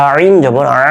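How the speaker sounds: slow, pressed "আইন জবর আ"; throat pronunciation. Shown as a demonstration. Teacher showing where the sound comes from, pressed "আইন". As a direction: squeeze the opening vowel of "আইন" and the final "আ". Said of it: "উচ্চারণটা এখান থেকে আসবে আপনার".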